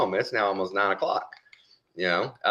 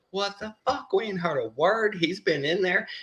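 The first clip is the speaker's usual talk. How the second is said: retold panic; 12 semitones higher than usual. Family waiting outside with no word, imitated high-pitched panic. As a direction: imitate worried people in a raised pitch. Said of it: "and they're panicking".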